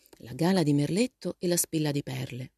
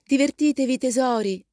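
bright send-off called out high and loud; exclaiming; 8 semitones higher, 6 dB louder than usual. A narrator's reading. Mrs March to her departing daughters, exclaiming. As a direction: call it out to departing children, raised pitch. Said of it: "esclamò la signora March".

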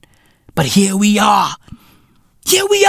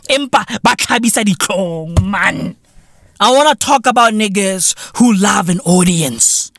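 ordinary talk

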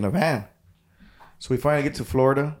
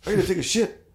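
a higher-pitched voice for the bandmate; imitating a bandmate; 8 semitones higher than usual. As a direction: lightly voice the bandmate rushing off. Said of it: "and he goes".

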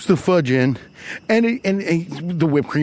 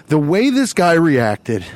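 ordinary delivery

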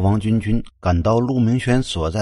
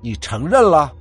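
loud, pointed question; accusing; 3 semitones higher, 7 dB louder than usual. A narrator's reading. Jealous friend seizes on He Yuan's admission, loud accusation. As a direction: pounce on the admission.

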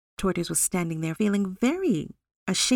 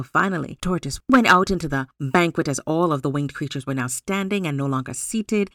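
ordinary delivery

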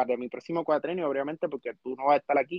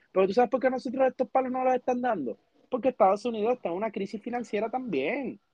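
normal talking voice

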